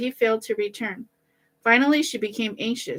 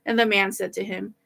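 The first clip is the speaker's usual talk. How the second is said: ordinary delivery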